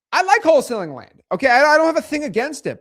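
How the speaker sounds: high, loud protest; defensive insistence. Real, not acted. Streamer clearing himself mid-rant about land, defensive insistence. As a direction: insist at the top of your range.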